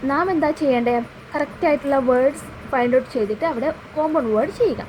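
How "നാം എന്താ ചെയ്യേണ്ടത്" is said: usual speaking voice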